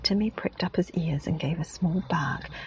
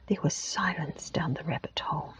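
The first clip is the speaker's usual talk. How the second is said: usual speaking voice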